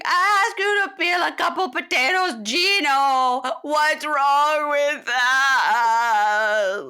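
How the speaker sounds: high, loud wailing sob; bawling. Retold or imitated. Wife sobbing at Gino over potatoes, bawling. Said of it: "So she's bawling, crying".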